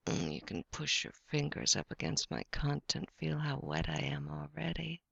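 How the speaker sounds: ordinary delivery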